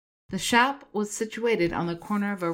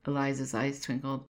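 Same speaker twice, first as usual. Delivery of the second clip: ordinary delivery, nothing marked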